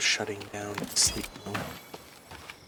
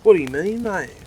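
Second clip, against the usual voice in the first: much higher and louder; indignant protest; 11 semitones higher, 10 dB louder, faster than usual. Acted. Richard insisting to Sal they check it, loud. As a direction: insist on going.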